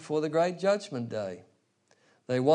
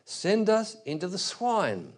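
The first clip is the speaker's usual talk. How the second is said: higher, slow acted plea; begging demons; 7 semitones higher, 3 dB louder, slower than usual. Demons beg the Lord Jesus through the man, pleading. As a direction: cry out a drawn-out request in raised pitch. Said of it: "begged him, saying".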